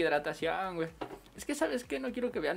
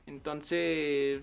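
ordinary talk

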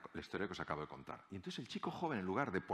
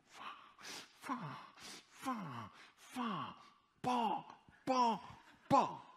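mock ranting gibberish; 13 semitones higher, much slower than usual. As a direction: imitate a furious tirade with nonsense syllables. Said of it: "después de la andanada". (parloteo burlón imitando una bronca)

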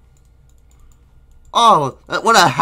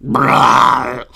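ordinary delivery

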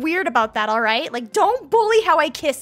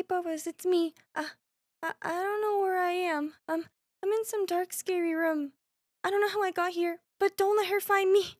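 soft, higher, timid voice; hushed fear; 4 semitones higher, 6 dB quieter than usual. Acted. Lost in some dark scary room, hushed fear. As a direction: speak small and furtive, afraid of being found.

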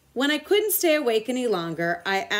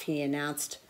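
ordinary delivery

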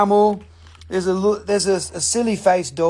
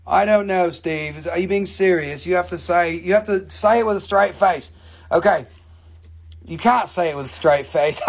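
ordinary talk

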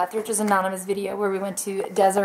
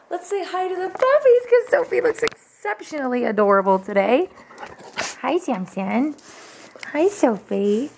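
doting puppy talk; 4 semitones higher than usual. Real, not acted. Greeting the puppies, doting.

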